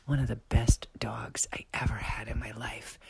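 hushed secret whisper. Real, not acted. Confiding a secret about the old dog, whispered. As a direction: whisper it so nobody else hears.

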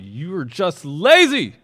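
slow, very loud acted shout. A narrator's reading. Pharaoh rebukes the pleading foremen, shouting. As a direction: bellow the accusation as Pharaoh, drawn out and high. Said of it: "It says he shouted, so I shouted".

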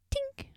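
soft imitated bottle tink; mimicking a bottle being hit; tink sound. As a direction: tap out a quiet glassy tink. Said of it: "sounds like someone hitting a beer bottle".

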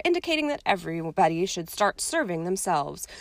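ordinary delivery

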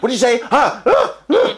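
high, loud outraged challenge; hammed-up furious outburst; mock going mad. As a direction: explode like someone losing it. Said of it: "They go mad, like".

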